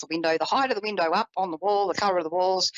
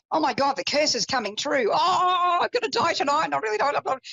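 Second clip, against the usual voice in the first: high, pleading mock panic; a hint of terrified grandpa, pitched high; panicky grandpa; 9 semitones higher than usual. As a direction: imitate a frightened old man begging.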